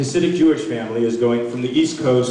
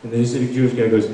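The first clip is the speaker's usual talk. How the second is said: normal talking voice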